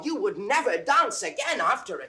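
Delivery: excited calling. Acted. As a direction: burst in breathless with news for the master. Servant bursts in calling master.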